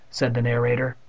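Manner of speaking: ordinary delivery, nothing marked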